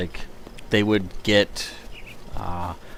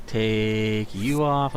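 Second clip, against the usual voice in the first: slower, softer odd voice; quiet put-on voice; 3 semitones lower, 4 dB quieter, much slower than usual. Shown as a demonstration. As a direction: echo it in a silly low voice.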